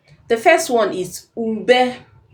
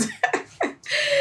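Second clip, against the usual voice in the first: a burst of high light laughter; 5 dB quieter than usual. Real, not acted. Teacher laughing at the end of the lesson, high and light.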